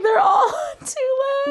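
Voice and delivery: very high, loud yell; excited shout. Retold or imitated. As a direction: shriek it in disbelief. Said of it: "Kat was like".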